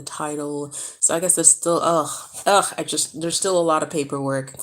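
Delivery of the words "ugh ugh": loud double "ugh"; exasperated groan. Real, not acted. Vlogger dreading the remaining paperwork, loud exasperated groan. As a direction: groan it out loudly, fed up.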